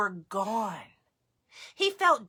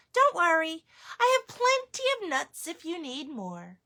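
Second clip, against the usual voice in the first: higher grandma voice; grandmother voice; 7 semitones higher than usual. Acted. Baba Brena reassuring the bear, higher voice.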